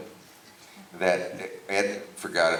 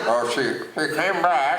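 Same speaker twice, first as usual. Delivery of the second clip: higher, louder impression of a TV host; Ed Sullivan; 10 semitones higher, 5 dB louder than usual. Interviewer mimicking the host who went blank, loud impression. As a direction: blurt the forgotten line back, pitched up. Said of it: "And Ed says".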